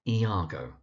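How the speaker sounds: Iago said ee-AH-go; clearly modelled Iago with "ee" at the start; correct pronunciation. Shown as a demonstration. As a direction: settle on Iago as ee-AH-go. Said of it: "So his name is".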